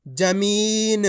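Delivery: जमीन with the ee of मी held long; drawn-out reading. Shown as a demonstration. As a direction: stretch the middle of जमीन for learners. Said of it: "खींच खींच के पढ़ना है".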